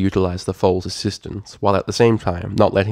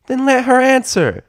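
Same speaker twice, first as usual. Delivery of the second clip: loud, high filly snap; bickering filly; 7 dB louder than usual. Acted. Filly telling her friend to let the mare answer, loud. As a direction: cut your friend off impatiently.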